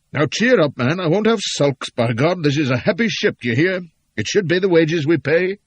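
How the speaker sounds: loud, high jovial bullying; bluff hearty order. Acted. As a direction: boom it with forced cheer. Captain's bluff jolly command.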